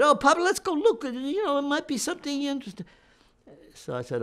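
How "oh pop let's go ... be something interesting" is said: imitating his daughter. Daughter urging him into shop.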